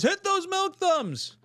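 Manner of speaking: high, drawn-out catchphrase; hammed-up call-out. Hammed-up reading of viewer's catchphrase.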